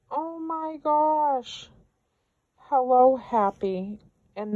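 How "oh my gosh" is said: high, drawn-out gasp of delight; real high-pitched amazement; awed delight. Real, not acted. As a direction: stretch it out high, overcome by the gift. Awed reaction to a gift.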